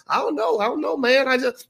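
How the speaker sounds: put-on old man shrugging. Old man voiced in story.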